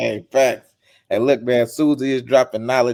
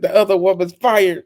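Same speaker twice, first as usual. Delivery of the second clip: high, loud echo of the boss; amused mock decree; 9 semitones higher, 6 dB louder than usual. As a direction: repeat the firing in mock proclamation. Host echoes the producer's decree.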